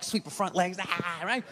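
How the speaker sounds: usual speaking voice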